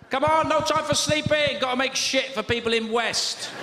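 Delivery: hammed-up Chinese boss; boss urging workers. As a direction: urge them on in a high mock Chinese accent.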